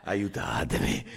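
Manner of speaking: low, drawn-out mock plea; mock plea for help. Mock plea after colleague's teasing. (finta richiesta d'aiuto)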